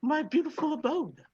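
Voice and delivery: high, hammed-up Irish granny voice; Irish Mrs. Doubtfire. Dwarf bartender welcoming the musician, terrible Irish accent. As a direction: play a badly faked Irish Mrs. Doubtfire. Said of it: "Irish Miss Doubtfire".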